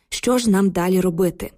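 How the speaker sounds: ordinary delivery, nothing marked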